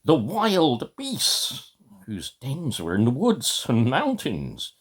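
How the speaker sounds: reading Peter Pan aloud, slow and drawn out; story narration. A narrator's reading. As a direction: tell it like a bedtime story.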